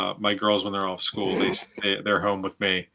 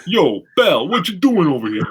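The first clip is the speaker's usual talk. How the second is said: put-on high, loud tough New York accent; thick New York accent; 7 semitones higher, 4 dB louder than usual. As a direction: play it as a brash New York tough guy. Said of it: "real thick New York accents".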